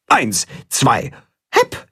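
Anzählen mit hohem Ruck auf „hepp“; gerufen. Acted. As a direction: gemeinsam anpacken und rufen. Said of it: "rufen das Katzenhuhn und Willi". (beim Ausschütten des Eimers gemeinsam gerufen)

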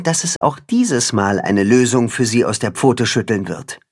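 ordinary delivery

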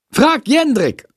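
brisk teacher reply, pitched high; energetic. A narrator's reading. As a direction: answer firmly in a high, energetic voice. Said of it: "antwortet Frau Schmidt energisch". (energisch, mit hoher Stimme)

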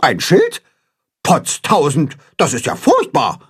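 mildly hammed-up goose alarm; Grimm, agitated chatter. A narrator's reading. Goose Grimm hears it is a sign, agitated. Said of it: "schnattert Grimm aufgewühlt".

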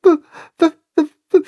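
high, crying stutter; frightened calf can't get words out; high tearful stammering calf; shy stammer. A narrator's reading. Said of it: "fragt das schüchterne Kälbchen".

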